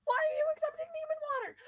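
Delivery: put-on panicked old priest, loud and high; frantic shouting priest; freaking out. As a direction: shriek the accusation in alarm. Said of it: "Just freaking out".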